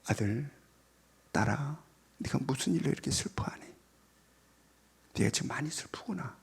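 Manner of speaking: soft, whispered comforting; gentle parent. Retold or imitated. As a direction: softly comfort a sad child. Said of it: "공감하라". (슬픈 아이를 부드럽게 달래며 속삭이듯)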